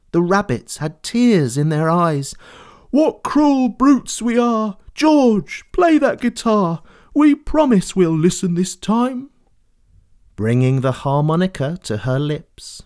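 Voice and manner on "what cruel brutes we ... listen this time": ashamed herd asking for music; remorseful animals. Acted. Animals beg George to play, high and remorseful.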